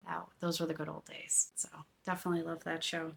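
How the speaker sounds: fast fond whisper; nostalgic whisper. Real, not acted. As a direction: let it slip out in a whisper. Fond memory of old seasons.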